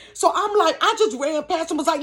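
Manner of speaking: ordinary delivery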